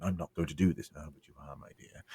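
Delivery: hushed, low slimy threat; very soft and low, creepily polite; creepy, slimy menace. Acted. Villain to the woman he has cornered, creepy menace. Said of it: "You know, that sort of creepy".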